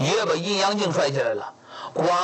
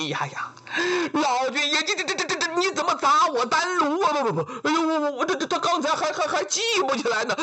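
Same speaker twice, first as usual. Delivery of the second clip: high, stammering panic, words tripping over each other; a rattled Taoist immortal sputtering in shock; flustered stammering immortal; 8 semitones higher, faster than usual. Acted. Taoist immortal sputtering at Laojun over the smashed furnace, high panicked stammer.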